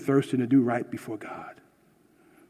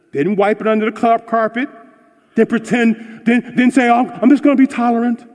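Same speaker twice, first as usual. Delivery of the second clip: high, loud preaching at full force; fiery preaching; 9 semitones higher, 9 dB louder than usual. Acted. Pastor preaching to his church, loud and high. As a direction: preach it hard to the congregation.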